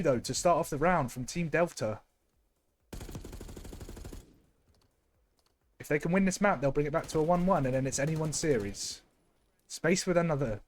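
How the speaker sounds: caster's match call, a bit brighter; live play-by-play. Acted. Caster calling the round for the stream, live play-by-play.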